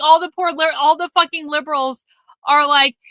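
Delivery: ordinary talk, nothing marked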